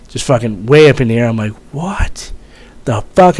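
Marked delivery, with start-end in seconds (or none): 1.7-3.4 high-pitched startled "what the fuck"; pitched-up incredulous exclamation; retold disbelief. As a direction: relive the shock of seeing it. Retelling seeing gun tipped.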